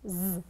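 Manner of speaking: a drawn-out buzzing "Z"; Z sound. Shown as a demonstration. Teacher names the sound in valise, buzzing "Z". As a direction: sound "Z" alone, not its letter name. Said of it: "c'est le son Z".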